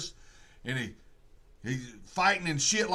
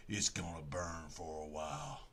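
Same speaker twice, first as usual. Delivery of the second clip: hushed threat; 17 dB quieter than usual. Retold or imitated. Vicky's revenge line, retold.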